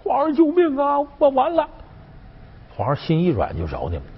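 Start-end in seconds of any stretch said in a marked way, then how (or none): 0.0-1.7 very high, put-on wail for help; mock crying plea. Shown as a demonstration. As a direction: demonstrate how to beg the emperor in tears. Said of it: "你们齐刷都跪下哭". (假哭着尖声喊救命)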